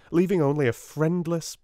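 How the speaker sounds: ordinary delivery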